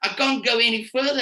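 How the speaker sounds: imitating a child faking hurt in Afrikaans; lightly whining Afrikaans kid; mock whiny fake injury. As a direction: play the schoolkid pretending to be injured. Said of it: "fake an injury and say".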